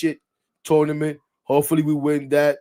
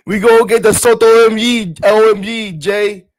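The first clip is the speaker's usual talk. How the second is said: louder and higher hype call; excited hype; 7 semitones higher, 11 dB louder than usual. Real, not acted. Streamer hyping the Soto shirt to chat, loud and excited. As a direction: pump up the chat.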